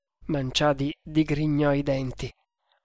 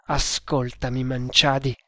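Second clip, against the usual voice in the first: ordinary delivery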